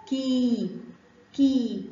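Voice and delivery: كي held as a long "kii"; separate, drawn-out كي; long ya sound. Shown as a demonstration. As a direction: stretch the "ee" in كي slowly. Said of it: "كاف كسرة مد بالياء".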